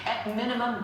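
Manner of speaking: ordinary talk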